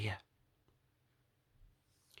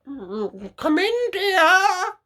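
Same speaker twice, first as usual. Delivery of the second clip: high, louder put-on granny voice; the wolf faking a sweet old lady, pitched way up; wolf posing as Grandma; 9 dB louder, much slower than usual. Wolf in Grandma's bed answers the girl, high loud fake granny voice. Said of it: "said the wolf".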